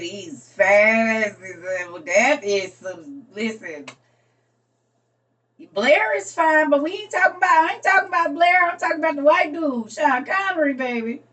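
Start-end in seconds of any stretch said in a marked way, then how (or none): 5.7-11.2 higher, louder, fast insistence; excited insistence. Real, not acted. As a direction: set them straight, all fired up about him. Correcting friend, insisting on Connery.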